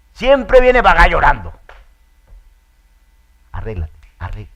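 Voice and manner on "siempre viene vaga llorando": louder, higher teasing jab; mocking shout. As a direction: poke fun at the crybaby. Mocking someone crying. (burla a gritos)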